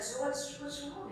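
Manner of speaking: retold stranger's tentative, hushed question; gentle concern. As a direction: ask it softly, as if to someone upset. Retold kindly stranger asking gently.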